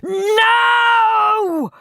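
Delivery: high, loud, long cry; screaming. A narrator's reading. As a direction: scream it in horror. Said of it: "screamed Henry".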